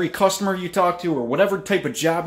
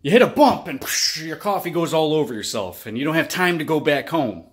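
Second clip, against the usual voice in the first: normal talking voice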